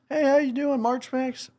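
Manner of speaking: mock-friendly high-pitched greeting; chirpy greeting. Buddy greets the dad digging outside, high chirpy hello.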